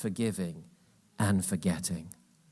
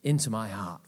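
belligerent declaration; 7 semitones higher than usual. Shown as a demonstration. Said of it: "Belligerence is the best word".

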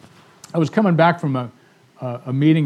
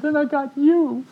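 delighted; 11 semitones higher, slower than usual. Retold or imitated. Woman tells how she reached him.

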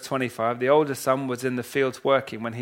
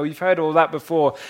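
ordinary talk

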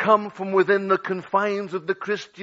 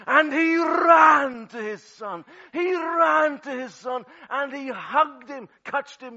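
preaching at full force; 9 semitones higher, 6 dB louder than usual. Acted. Preacher to congregation, ringing full-force preaching. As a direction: call it out to the whole church.

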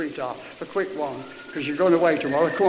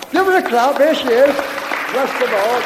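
calling out; 3 semitones higher, 6 dB louder than usual. Real, not acted. Leader calls for applause.

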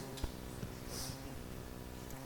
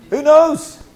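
higher, louder challenge; rousing question; 7 semitones higher, 10 dB louder than usual. Real, not acted. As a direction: throw it out to the room.

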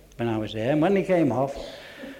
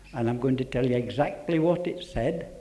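ordinary talk, nothing marked

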